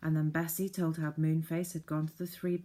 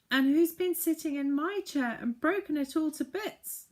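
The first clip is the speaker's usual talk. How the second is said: very high, louder tearful squeak; squeaky baby bear; 11 semitones higher, 5 dB louder than usual. Acted. Baby bear finding his broken chair, high squeaky voice. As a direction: squeak it like a tiny upset cub. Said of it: "squeaked the baby bear in tears".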